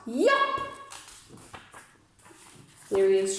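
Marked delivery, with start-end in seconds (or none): 0.1-0.6 high, bright little yell; small shout. Acted. The smallest Who finally shouting to be heard, a high yelp. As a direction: pop out the tiny boy's yup. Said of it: "and he shouted out".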